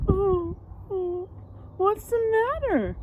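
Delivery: high, worried giant voice; concerned giant. Acted. Giant asks the sniffing giraffe, concerned and high. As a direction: ask kindly with a lifted voice. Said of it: "asked George".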